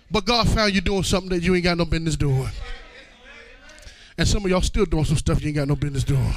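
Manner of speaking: normal talking voice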